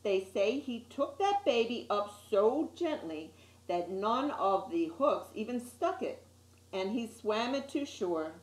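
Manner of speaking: plain reading voice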